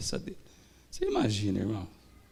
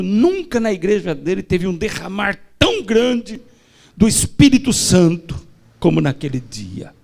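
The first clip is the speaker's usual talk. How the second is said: higher and louder proclamation; fervent preaching; 5 semitones higher, 9 dB louder than usual. Acted. Preacher proclaiming to the church, loud and high. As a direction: proclaim the outpouring.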